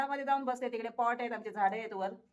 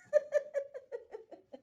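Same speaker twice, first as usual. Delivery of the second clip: high laugh; 13 semitones higher than usual. Real, not acted. Laughs at stubborn cat.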